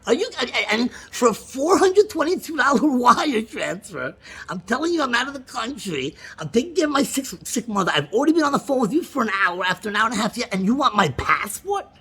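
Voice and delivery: exasperated disbelief. Retold or imitated. Retelling to Lana his high exasperated protest at the agent. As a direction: replay the indignant protest to the agent. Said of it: "And I said".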